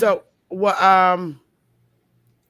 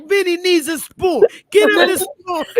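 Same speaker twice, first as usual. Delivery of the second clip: high-pitched Italian-American family voice; Italian accent; 8 semitones higher than usual. Pretend. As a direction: play an Italian relative, pitched up. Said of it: "are we doing our Italian accent".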